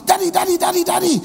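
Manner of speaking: put-on high, eager child calling her daddy; excited little girl. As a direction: bounce it like a thrilled kid. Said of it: "big, excited".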